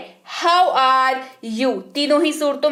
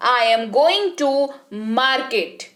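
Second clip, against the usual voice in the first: each word slow and separate for students; slow demo reading; much slower than usual. Shown as a demonstration. Teacher slowly modelling the sentence for learners. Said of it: "आपको उच्चारण वाले शब्द पर ध्यान देना है".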